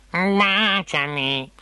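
slow, croaking frog plea; tremulous croak. A narrator's reading. Frog asking princess for a kiss, tremulous croak. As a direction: draw it out as a quavering frog. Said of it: "Con un tremulo gracidio".

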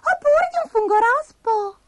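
squeaky falsetto ending a list; tiny creature musing aloud; Abigail the little spider. A narrator's reading. Spider ends her list.

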